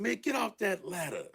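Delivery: mock-alarmed command; exasperated order. Producer ordering Master P off the ladder, exasperated. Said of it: "I said".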